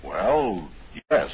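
normal talking voice

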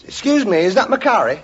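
football fan. Acted. Football fan questioning a waiter, put-on character voice. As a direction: ask as an eager customer. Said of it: "a football fan approaches".

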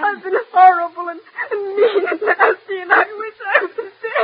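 tearful wail of self-blame; crying. Acted. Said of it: "stop crying".

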